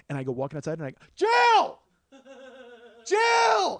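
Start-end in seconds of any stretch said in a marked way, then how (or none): 1.1-3.8 very high, loud calling-out; all-out yell. Retold or imitated. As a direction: yell the name as if searching a crowd. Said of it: "yell it as loud as I can".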